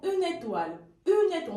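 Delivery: Une étoile run together as u-nétoile; linked une étoile. Shown as a demonstration. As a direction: carry the N into étoile. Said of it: "le N passe ici".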